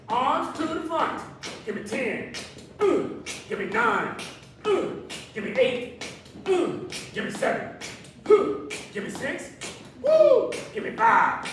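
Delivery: high, loud pumped-up rep count; excited countdown. Acted. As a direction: whoop between counts to fire them up. Counting down reps, whooping.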